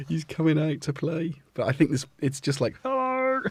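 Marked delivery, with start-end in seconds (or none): 2.8-3.4 much higher squeaky plant greeting; voicing the plant. Owner voices his plant Kent saying hello, high and squeaky. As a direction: give the little plant a tiny voice. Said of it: "Not that Kent talks like that".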